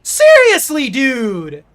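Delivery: high, loud outcry; real fed-up yell; exasperated shout. Real, not acted. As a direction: throw it at the screen. Continuing frustration.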